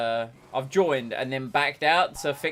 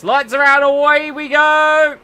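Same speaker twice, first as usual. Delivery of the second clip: much higher, louder, stretched commentator call; race start call; 12 semitones higher, 12 dB louder than usual. Acted. Calling the race start to viewers, loud excited commentator.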